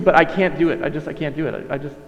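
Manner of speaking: normal talking voice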